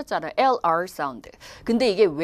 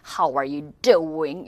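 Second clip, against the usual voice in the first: drawn-out, exaggerated American "How are you doing"; stretched American drawl with big gestures; American accent; 5 semitones lower, much slower than usual. Shown as a demonstration. Teacher mimics how Americans greet, exaggerated American accent. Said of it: "이렇게 막 제스처 쓰면서".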